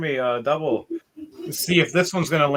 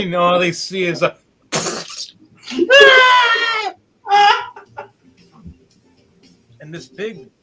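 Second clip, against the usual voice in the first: usual speaking voice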